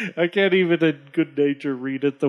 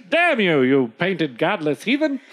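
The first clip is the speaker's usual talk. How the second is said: hammed-up outraged trader; cursing trader; 2 semitones higher than usual. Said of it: "The trader says".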